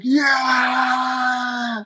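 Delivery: long high yell, then a quick echo; proud victory shout. Retold or imitated. Reliving catching a twin in a footlock, shouting. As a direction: roar it like you caught the footlock. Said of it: "And I was proud of myself".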